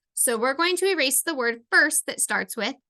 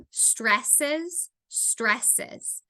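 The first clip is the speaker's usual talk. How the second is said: ordinary talk, nothing marked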